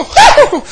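high loud woo; shouted praise; joyful whoop. Retold or imitated. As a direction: whoop like the spirit hit. Preacher whooping in church.

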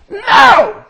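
high, emphatic acted refusal; defiant refusal. Preacher re-enacts his first answer to God, defiant.

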